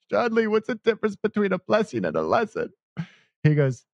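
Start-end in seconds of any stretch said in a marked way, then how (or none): 0.1-2.7 high, choked-up question, imitating tears; retold crying. Man asks his friend about blessings, mock crying. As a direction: ask it high-pitched, as if through tears. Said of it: "And I'm crying".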